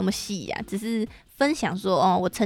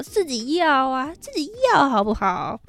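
higher, slow coaxing; playful pleading; 5 semitones higher than usual. Real, not acted. Urging listeners to comment, playfully coaxing. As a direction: coax the listeners.

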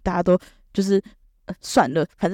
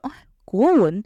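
louder, higher surprised grunt; teacher's mock surprise; 5 semitones higher, 4 dB louder, slower than usual. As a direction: react like a puzzled teacher. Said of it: "然后老师就".

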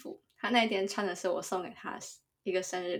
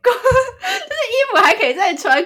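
high, loud words bursting through laughter; laughing hard; 12 dB louder than usual. Real, not acted. Friend defending her logic about the clothes, laughing hard. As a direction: crack up while trying to explain.

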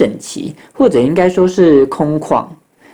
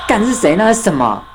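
high-pitched startled questions; acted jolt of fright; 7 semitones higher than usual. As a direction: blurt it out on catching sight of something. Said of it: "我吓一跳".

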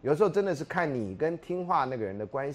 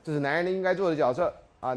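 ordinary talk, nothing marked

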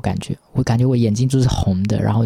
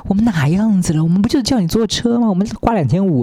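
arrogant driver; 2 semitones higher than usual. Retold or imitated. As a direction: sneer it back like a cocky driver. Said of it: "那个司机就是很拽".

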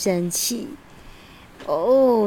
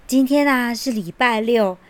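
ordinary delivery, nothing marked

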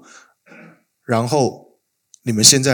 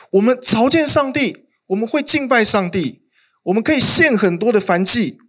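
much higher, eager bragging list; boastful Israel; 10 semitones higher than usual. Acted. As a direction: brag about all your offerings. Voicing Israel's boastful worship. (高声夸耀地列举)

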